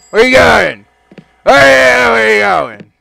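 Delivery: loud, high shout; loud clerk yelling after someone; shouting after customer. Acted. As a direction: yell after them.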